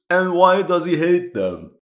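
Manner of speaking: baffled, demanding an answer; incredulous question. Real, not acted. Historian questioning the game's story, loud incredulous ask. Puzzled by the officer's hatred.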